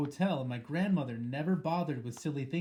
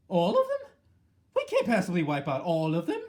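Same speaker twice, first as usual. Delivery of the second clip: a leap far higher, louder in disbelief; shocked outburst; 4 semitones higher, 7 dB louder than usual. A narrator's reading. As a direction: gasp out the question in horror. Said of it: "saying aloud".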